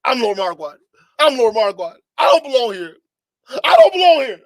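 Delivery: high wailing plea, near crying; whiny crying shout. Retold or imitated. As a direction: wail it like a desperate prisoner. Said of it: "That's you".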